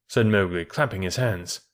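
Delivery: ordinary delivery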